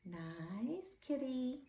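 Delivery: slow, soft soothing croon; soft coaxing. A narrator's reading. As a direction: lull the cat with repetition. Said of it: "he said over and over again".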